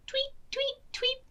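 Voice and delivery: high whispered bird tweets. Acted. Bird in the tree, high whispered tweets. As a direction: chirp softly like a bird.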